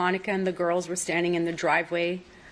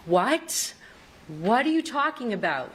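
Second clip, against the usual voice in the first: retelling shock; 4 semitones higher than usual. Retold or imitated. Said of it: "passed the phone to me in shock".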